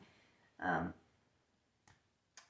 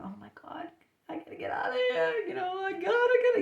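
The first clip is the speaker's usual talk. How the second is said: fast, high mock-panicked squeal; inner panic; 13 semitones higher, faster than usual. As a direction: act out rising panic, desperate to escape. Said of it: "You can feel the panic".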